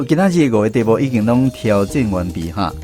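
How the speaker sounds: ordinary talk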